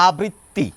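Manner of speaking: আবৃত্তি with a single careful t; deliberate formal আবৃত্তি, no doubling; learned careful form; effortful formal form. Shown as a demonstration. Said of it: "ওই চেষ্টাকৃত উচ্চারণ".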